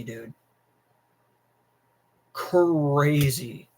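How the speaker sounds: ordinary talk, nothing marked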